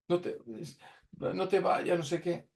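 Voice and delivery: high, half-hearted imitation of crying relatives; mock tearful plea. (súplica llorosa imitada, más aguda)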